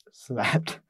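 breathy whispered word. Real, not acted. Telling a friend about arriving exhausted, whispered. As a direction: whisper it like you're dropping off. Tired end of the trip.

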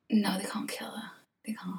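whispered, pleading denial; soft mock-distraught plea; hushed protest. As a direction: protest softly, almost in a whisper. Distressed at a favourite character's predicted death.

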